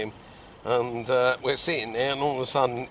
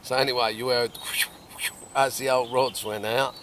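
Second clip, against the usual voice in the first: usual speaking voice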